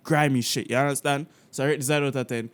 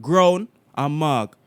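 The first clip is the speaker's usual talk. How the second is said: slow, loud Jamaican-accent demo; 5 dB louder than usual. Shown as a demonstration. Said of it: "do it in my Jamaican accent".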